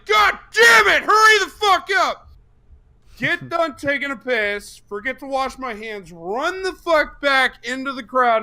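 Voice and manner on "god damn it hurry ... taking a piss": high, loud frantic yelling; frantic shout. Retold or imitated. Fan yelling at himself to hurry back to the show. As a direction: yell at yourself to hurry. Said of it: "I'm like".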